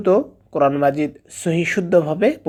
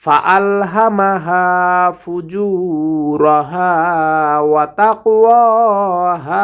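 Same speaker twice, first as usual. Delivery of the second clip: Quran recitation; 2 semitones higher, 3 dB louder, much slower than usual. Acted. Teacher reciting the full passage after the lesson. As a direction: chant each verse with long drawn vowels.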